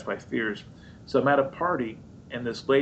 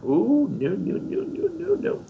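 mock dismay. Shaking head at praying crowd.

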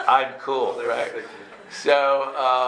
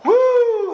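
very high, loud woo; whoop of relief; 10 dB louder than usual. Retold or imitated. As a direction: let out a big mock whoop of relief. Relief after the scare.

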